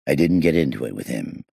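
ordinary delivery

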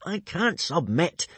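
high, slow indignant protest. A narrator's reading. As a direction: protest as a put-out boy. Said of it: "said Hiccup indignantly".

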